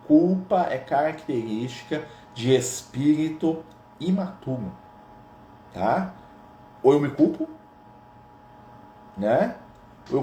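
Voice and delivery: lightly voiced Lucas, slow and deliberate; a slow, measured hint of Lucas teaching; imitating Lucas. Preacher imitates Lucas explaining guilt, slow and measured. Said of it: "vou imitar o Lucas aqui".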